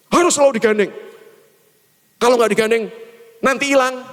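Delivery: high-pitched imitated maternal nagging; mother's warning. Boy imagining his mother's warning, high imitated scolding. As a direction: lay down the rule in mum's high voice. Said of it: "membayangkan mama saya berpesan".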